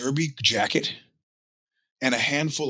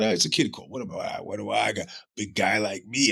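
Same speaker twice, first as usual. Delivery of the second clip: ordinary delivery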